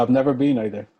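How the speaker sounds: ordinary delivery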